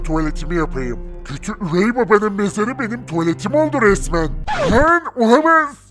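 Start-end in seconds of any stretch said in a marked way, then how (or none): 4.7-5.8 shocked shout. Acted. Shock at stepfather back.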